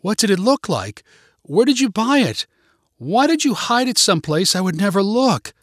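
high-pitched, exasperated questioning; shocked, ungracious demands. A narrator's reading. Girlfriend learns of the hidden ring, shocked, ungracious demands. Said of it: "too shocked and disappointed to respond graciously".

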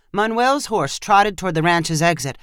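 ordinary delivery, nothing marked

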